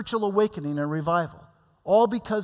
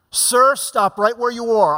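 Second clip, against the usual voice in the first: raised, firm order to halt; commanding call to stop; 5 semitones higher, 7 dB louder than usual. Retold or imitated. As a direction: hold him back with a raised, firm command. Retold command to a threatening man.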